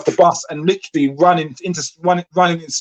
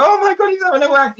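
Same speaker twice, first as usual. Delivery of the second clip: high, shrill-free mock alarm, much higher; mock shocked gasp; 13 semitones higher, 3 dB louder than usual. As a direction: play the scandalised onlooker. Mock shocked bystander quote.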